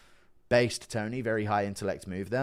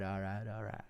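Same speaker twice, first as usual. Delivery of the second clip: soft Texan actor catchphrase; Matthew McConaughey; 10 dB quieter than usual. Acted. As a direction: drop into a soft Texas impression. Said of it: "I've never tried a McConaughey impression".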